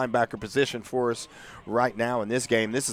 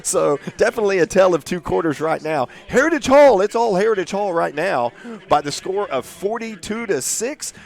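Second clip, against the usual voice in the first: excited announcer; 6 semitones higher, 7 dB louder than usual. Real, not acted. Announcer signing off at halftime.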